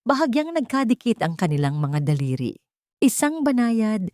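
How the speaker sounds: ordinary delivery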